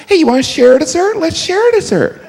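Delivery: high, loud mock wheedling; whiny dessert sharer. Mocking dessert-sharing diner.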